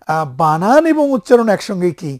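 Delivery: usual speaking voice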